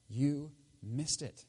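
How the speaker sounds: slow emphasis. Retold or imitated. Peter's core message.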